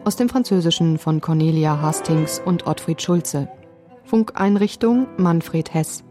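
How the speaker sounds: ordinary talk, nothing marked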